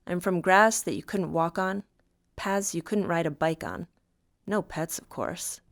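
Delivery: ordinary delivery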